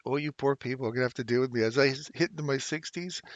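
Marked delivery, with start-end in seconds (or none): none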